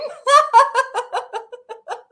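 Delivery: loud, high helpless cackling; real burst of laughter. Real, not acted. Reader cracking up at the line, loud high laughter. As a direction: explode into high, rapid laughter.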